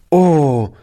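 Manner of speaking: put-on a boy's dismayed little exclamation; sudden child's oh of shock; surprised cry. A narrator's reading. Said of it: "rief Toni".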